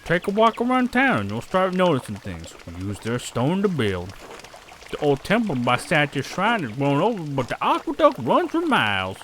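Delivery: put-on accent, lively local guide; chatty townsperson. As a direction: show off the town in an accented character voice.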